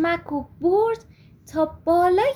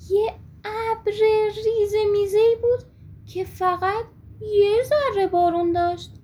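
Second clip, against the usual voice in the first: plain reading voice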